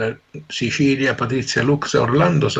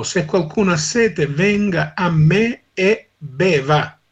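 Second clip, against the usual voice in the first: raised, solemn Bible reading; solemn scripture reading; 4 semitones higher than usual. Acted. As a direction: proclaim the Gospel passage to the listeners. Scripture reading to radio listeners. (lettura solenne del Vangelo)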